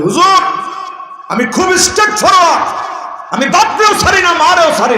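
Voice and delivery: hammed-up boast of a rude son; mock boastful follower. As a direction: parody the man who calls himself blunt. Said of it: "আমরা একটা কথা বলি".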